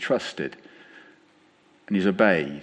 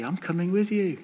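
tender promise; 9 semitones higher than usual. Retold or imitated. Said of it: "he calls Moses".

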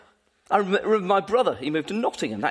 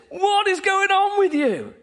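much higher and louder, exasperated demand; exasperated Paul; 10 semitones higher, 4 dB louder than usual. Retold or imitated. Paul confronting the divided Corinthian church, exasperated. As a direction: throw up your hands at the church. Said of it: "Paul says".

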